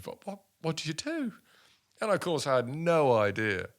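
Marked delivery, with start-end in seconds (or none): 0.0-1.3 much higher, stammering mock panic; a flustered man at a loss; panicky. Panicked retold self-talk.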